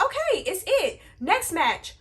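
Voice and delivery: high, loud retold thought; excited. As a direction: pitch it up like you're done. Retelling own reaction.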